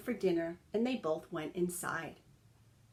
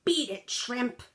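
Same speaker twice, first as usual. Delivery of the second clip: growl; 5 semitones higher, 3 dB louder than usual. A narrator's reading. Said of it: "growled a Doberman".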